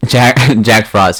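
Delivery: loud laughing, slow; laughing through the words. Real, not acted. Laughing loudly at the idea.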